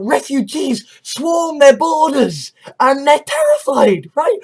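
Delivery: ordinary talk, nothing marked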